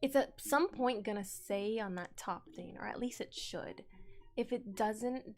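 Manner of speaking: ordinary talk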